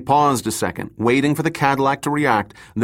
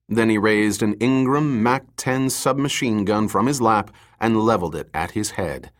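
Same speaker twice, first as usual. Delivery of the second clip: usual speaking voice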